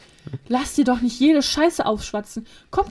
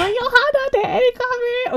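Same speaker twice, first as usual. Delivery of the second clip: much higher, alarmed cry; panicked warning; 13 semitones higher than usual. Retold or imitated. Dylan warning Johanna about the truck, high panic.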